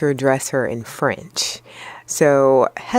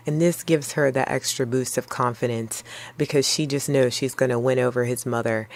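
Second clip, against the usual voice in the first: ordinary talk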